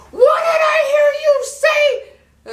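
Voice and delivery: very high, loud, slow furious shout; outraged mother demanding an answer; furious mom. Retold or imitated. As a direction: Latin mama shrieking at her daughter. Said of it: "She comes out like this".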